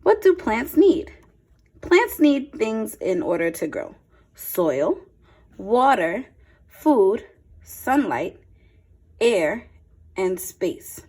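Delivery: clear, lively picture-book read-aloud; a teacher's storytime voice, a touch brighter; reading to children. Acted. Reads picture book to class.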